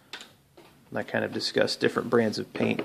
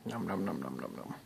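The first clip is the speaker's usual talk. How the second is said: mock munching; 6 semitones lower, 12 dB quieter than usual. Mock eating noise again.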